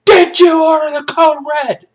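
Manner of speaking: high, loud, forceful demand; demanding question. Retold or imitated. Kaffee finally demands the answer from Jessup, loud and high. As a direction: pin him down with the big question. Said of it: "He's like".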